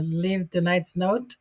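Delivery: ordinary talk, nothing marked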